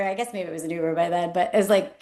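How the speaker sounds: ordinary talk, nothing marked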